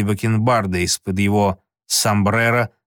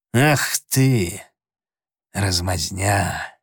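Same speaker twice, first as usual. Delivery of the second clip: scornful; 3 semitones lower, much slower than usual. A narrator's reading. Joe scorns his partner.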